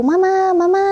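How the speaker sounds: high, loud call up the stairs; mock yell calling Mama from upstairs; yelling for mom. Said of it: "teriak-teriak manggil mamaku".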